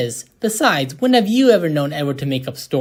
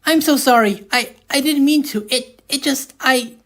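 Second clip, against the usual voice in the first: flustered apology; 7 semitones higher than usual. Acted.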